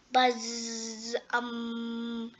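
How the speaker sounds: long, drawn-out imitated bee buzz; soft droning hum of a hive. A narrator's reading. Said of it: "they buzzed".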